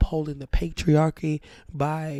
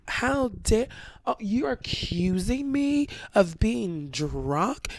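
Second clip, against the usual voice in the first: imitated indignation, higher and drawn out; mock outraged protest; mad; 6 semitones higher, much slower than usual. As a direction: act out an offended accuser, stretching the words. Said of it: "she got mad at me".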